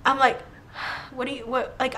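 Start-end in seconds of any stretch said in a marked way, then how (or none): 0.8-1.8 acting out panicked breath; soft, breathy disbelief, retold; breathing hard. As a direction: stammer softly on a heavy breath. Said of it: "I'm, like, breathing so hard".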